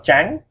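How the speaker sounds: trắng climbing sharply at the end; rising tone. Shown as a demonstration. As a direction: lift trắng upward. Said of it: "with the rising tone".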